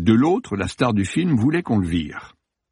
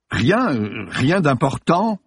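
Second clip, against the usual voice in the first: hesitant, raised, flustered voice; stammering; 6 semitones higher, slower than usual. A narrator's reading. Actor questioned by police, stammering evasively. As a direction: stumble evasively, stretching the words. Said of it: "balbutia Schenkel".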